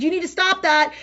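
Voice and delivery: loud, high command; firm high shout; yelled order. Retold or imitated. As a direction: yell it to stop her. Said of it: "and I yelled at her".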